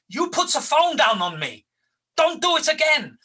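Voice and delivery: high, loud furious telling-off; angry caller. Retold or imitated. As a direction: build to a shouted warning. Caller berates him.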